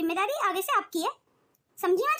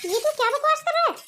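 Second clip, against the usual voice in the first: raised, high-pitched indignation; outraged; 5 semitones higher, 5 dB louder than usual. Acted. Mother to son on losing her house, outraged. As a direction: burst out louder in disbelief.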